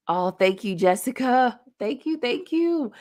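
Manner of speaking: holding back tears while thanking a friend; choked up. Real, not acted. Thanking Jessica live, fighting back real tears. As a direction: voice catching, pitch jumping high, slowed. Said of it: "you're gonna make me tear up".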